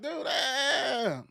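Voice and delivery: ordinary talk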